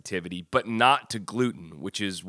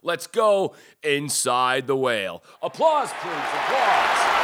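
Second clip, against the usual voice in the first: announcer voice; 7 semitones higher, 6 dB louder, slower than usual. Acted. Announcer calling for applause.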